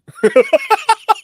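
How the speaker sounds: squealing high laugh. Real, not acted. Laughing at wife's remark.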